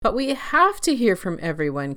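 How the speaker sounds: firm, pressing insistence; insisting. A narrator's reading. Compassion urges everyone to listen, insisting. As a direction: push the point gently but firmly. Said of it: "Compassion insisted".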